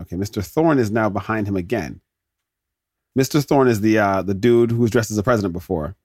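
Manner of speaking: usual speaking voice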